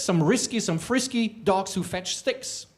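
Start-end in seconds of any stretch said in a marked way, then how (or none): none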